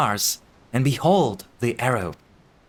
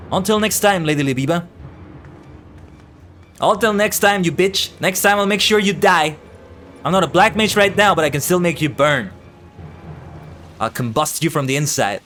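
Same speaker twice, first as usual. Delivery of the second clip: higher, loud spiteful villain; villain's threat; 7 semitones higher, 4 dB louder than usual. Acted. Bandit threatening Lady Labiba as she flees, spiteful. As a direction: spit the farewell threat.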